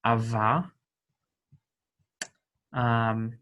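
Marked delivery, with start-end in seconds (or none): 0.0-0.7 "ava" with the lip biting a firm V; demo of V. Shown as a demonstration. Teacher to students, sounding out the V. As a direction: make sure "ava" has a V, not a W. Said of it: "not a W sound".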